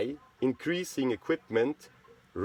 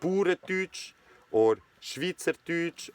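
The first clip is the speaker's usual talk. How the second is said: Bauerndeutsch or Schweizerdeutsch said slowly in native Swiss German; slow, native Swiss German naming of Bauerndeutsch and Schweizerdeutsch; Swiss German words; 5 semitones higher, slower than usual. Shown as a demonstration. Naming his own dialect to viewers, in native Swiss German. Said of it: "we call this".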